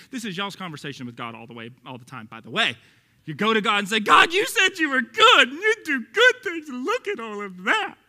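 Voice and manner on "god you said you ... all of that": high, loud, whiny, slow-drawn crying complaint; whiny complaint to God. Pretend. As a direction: whine at God like a sulking child, crying it out. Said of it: "That's what I think you sound like to God".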